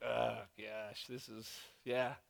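low, soft self-reproach; sheepish realization. Retold or imitated. Pastor realizing his heart is off, low and sheepish. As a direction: let it sink in, low and quiet.

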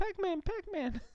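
put-on high excited child chanting; squeaky toddler chant; little kid voice. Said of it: "He's like".

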